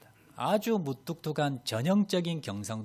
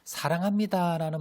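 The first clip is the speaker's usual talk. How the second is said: higher and louder, glowing with affection; 사랑합니다 lifted high and loud; loving emphasis; 5 semitones higher, 3 dB louder than usual. Shown as a demonstration. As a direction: sing out 사랑합니다 with feeling. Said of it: "말을 들으면 어때요?".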